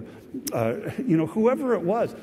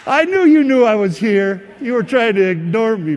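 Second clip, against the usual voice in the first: loud, higher, laughing through the words; laughing retort; 5 semitones higher, 9 dB louder than usual. Retold or imitated. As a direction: laugh while teasing a friend who ignored you.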